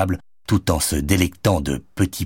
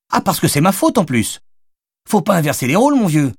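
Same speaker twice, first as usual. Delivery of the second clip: high-pitched, raised indignant comeback; indignant retort; 11 semitones higher, 3 dB louder than usual. A narrator's reading. Madeleine refusing the blame in the collision, high and indignant. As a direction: throw the blame right back at him. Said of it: "lui tâta-t-elle tête".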